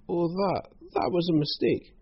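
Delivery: high, wheedling character voice; whiny villain making excuses. Acted. Croc excusing the burned village to Hal, whining. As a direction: brush it off in a high whine.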